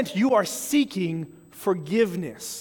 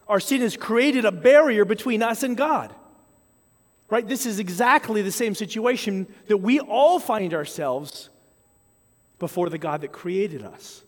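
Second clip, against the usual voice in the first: ordinary talk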